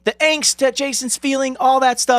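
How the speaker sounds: high, drawn-out lament; exaggerated high-pitched complaint; impassioned protest. Real, not acted. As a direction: plead for the lost scene, pitched right up. Lamenting a cut scene.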